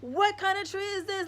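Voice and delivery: high, loud wonder at a tree; amazed at a tree. Retold or imitated. A retold first sight of the sacred tree, high, loud amazement. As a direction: exclaim in awe, high and loud. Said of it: "I instantly was like".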